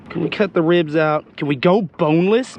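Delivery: normal talking voice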